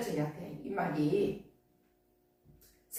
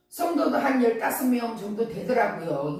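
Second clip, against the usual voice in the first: ordinary delivery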